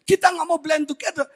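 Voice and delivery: ordinary talk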